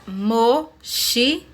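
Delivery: mochila split as mo, chi, la; syllable by syllable. Shown as a demonstration. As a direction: pause after each syllable of mochila. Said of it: "Podemos dividir as palavras em sílabas".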